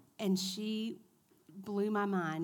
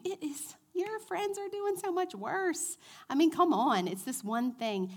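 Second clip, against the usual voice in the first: tempting enemy; 9 semitones higher, 4 dB louder than usual. Retold or imitated. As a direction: lure them in with a sly, sweet pitch. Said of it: "He will tell you".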